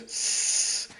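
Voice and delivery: soft unvoiced hiss; imitated creeper hiss. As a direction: hiss through the teeth like a creeper.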